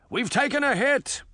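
high-pitched captain's alert; shouting. A narrator's reading. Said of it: "Sinbad shouted".

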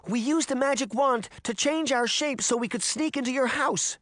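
high, pleading confession; distressed child explaining desperately; crying out. A narrator's reading. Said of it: "Daphne cried".

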